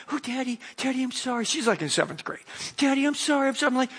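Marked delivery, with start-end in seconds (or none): 0.1-1.4 daughter apologizing. Retold or imitated. 2.8-3.7 daughter apologizing. Retold or imitated.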